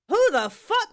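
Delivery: outraged inner voice at a rude coworker; indignant outburst. Retold or imitated. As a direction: fire it back like you've been insulted. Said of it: "that's what Penelope was saying".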